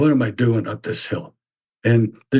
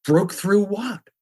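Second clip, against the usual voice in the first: puzzled; 7 semitones higher, slower than usual. Retold or imitated. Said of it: "I'm going like".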